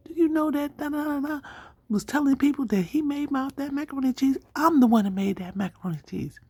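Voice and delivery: a woman fuming that the cook stole her credit; indignant lady voice. Retold or imitated. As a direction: ham up her outrage at the liar.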